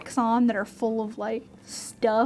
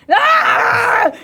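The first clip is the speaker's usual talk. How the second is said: high loud scream; goblin scream; 11 semitones higher, 10 dB louder than usual. Acted. GM voicing a goblin below, a high loud shriek. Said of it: "you hear someone be like".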